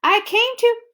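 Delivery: high, loud urgent start; Bill trying to explain in a hurry. Acted. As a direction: rush to explain. Bill cut off.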